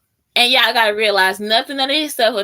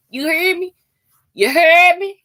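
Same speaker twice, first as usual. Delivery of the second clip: high challenge building louder; mock confrontational demand; 8 semitones higher, 4 dB louder than usual. Texan makes a fleeing listener listen, mock-angry demand. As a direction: demand attention in mock outrage.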